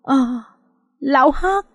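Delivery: taken aback, pitch jumping up; open-mouthed shock. A narrator's reading. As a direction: gasp out the name in alarm. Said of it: "miệng há hốc".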